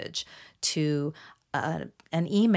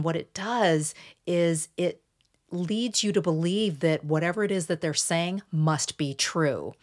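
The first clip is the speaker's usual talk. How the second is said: usual speaking voice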